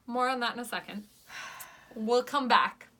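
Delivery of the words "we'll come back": usual speaking voice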